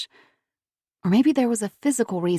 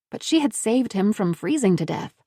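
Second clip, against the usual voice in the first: plain reading voice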